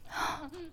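quiet surprised gasp; a small breathy gasp of surprise; soft gasp. Acted. Mock surprise at panties.

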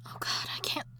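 giddy whisper, barely containing herself; excited whisper. Acted. Ghost wife whispering beside her sleeping husband. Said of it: "I'll wake him".

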